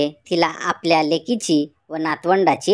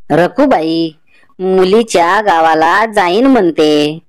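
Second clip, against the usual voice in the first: put-on louder, slow old woman's voice; old grandmother; 7 dB louder, slower than usual. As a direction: speak as the village grandmother, slow and loud. Old woman talks to neighbour.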